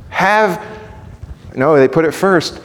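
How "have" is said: high-pitched cry, broken off; shrieking out. Retold or imitated. Preacher starts the blind men's plea, shrieking. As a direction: begin a high cry for help. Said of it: "shrieking out with the top of their voices".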